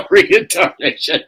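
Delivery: loud, high, slow laughing repeat. Real, not acted. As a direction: say it through real laughter. Laughing at the hillbilly pun.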